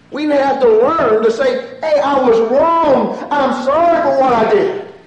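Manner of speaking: loud, high, slow fervent preaching; preaching at full force. Acted. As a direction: call the church to confess.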